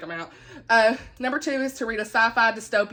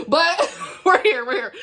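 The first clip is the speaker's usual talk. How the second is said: laughing; 7 semitones higher, 4 dB louder than usual. Real, not acted. Laughing after stumbling over the name.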